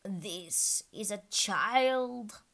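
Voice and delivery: put-on eagerly presenting a child; eager messenger voice. A narrator's reading. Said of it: "Haya replied eagerly".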